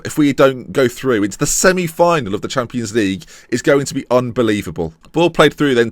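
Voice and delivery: usual speaking voice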